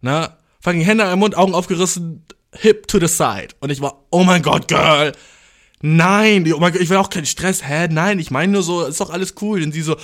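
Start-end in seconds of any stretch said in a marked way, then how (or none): 4.1-9.6 loud, gushing mock-queen reassurance; big diva oh-my-god, played up; camp queen. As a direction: defuse it with hammed-up girly shock. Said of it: "ich muss die Queen rauslassen".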